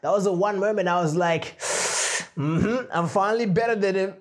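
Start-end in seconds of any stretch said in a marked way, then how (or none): 1.6-4.1 self-satisfied mock boast; smug. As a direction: hum it like you've won. Smug retold inner voice.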